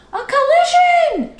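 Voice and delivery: high, loud alarmed cry; the whole bank yelling at once; crowd shouting. A narrator's reading. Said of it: "shouted the crowd on shore".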